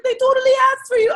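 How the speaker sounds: high, loud, fast eager mother; quick and keen, a mother insisting. Retold or imitated. Friend's mother insisting the family wants the guest.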